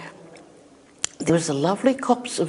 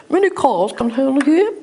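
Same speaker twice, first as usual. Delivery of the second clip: put-on high, louder upper-crust English accent; put-on English accent in a raised voice; 10 semitones higher, 5 dB louder than usual. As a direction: imitate a very English lady, pitched up high. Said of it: "in this very English accent".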